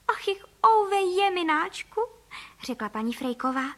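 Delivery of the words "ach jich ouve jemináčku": high, slow hammed-up wail; acted lament. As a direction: moan theatrically, stretching each word. Mother duck laments the lost house.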